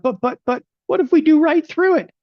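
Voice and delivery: high, loud mock-panicked stammer; panicky doubter. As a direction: play the nervous trader losing his cool. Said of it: "they're like".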